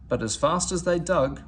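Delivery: usual speaking voice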